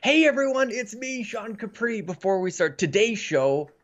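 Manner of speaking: bright, high show-opener voice; upbeat host intro. Acted. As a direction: greet the audience like a host.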